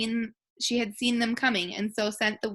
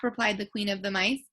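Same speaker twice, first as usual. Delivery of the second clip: usual speaking voice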